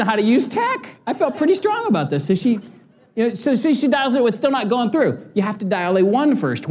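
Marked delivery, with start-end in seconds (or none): none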